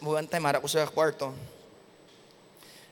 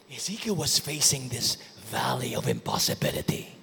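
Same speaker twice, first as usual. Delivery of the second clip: normal talking voice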